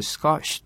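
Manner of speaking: スコッシュ as one syllable, "skosh"; 英語での発音. Shown as a demonstration. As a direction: close スコッシュ on "sh" without a vowel. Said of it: "っていうらしいよね". (スコッシュを英語風に「シュ」で終える)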